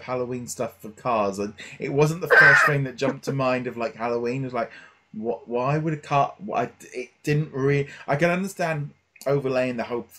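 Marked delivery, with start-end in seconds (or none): none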